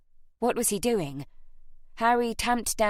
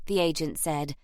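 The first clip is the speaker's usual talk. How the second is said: ordinary delivery, nothing marked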